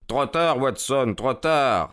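crying out. A narrator's reading. Holmes calls to Watson as the empty cart comes, crying out. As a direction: call it out with some urgency. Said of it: "s'écria Holmes".

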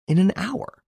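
high, rising, incredulous question; startled query with a sharp final rise; surprised echo. A narrator's reading. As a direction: echo it back in surprise, pitch shooting up. Host echoes it in disbelief.

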